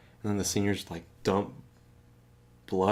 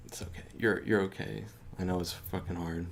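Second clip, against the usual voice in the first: soft comforting roommate; 2 semitones lower, 7 dB quieter than usual. Retold or imitated.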